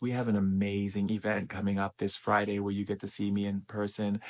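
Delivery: usual speaking voice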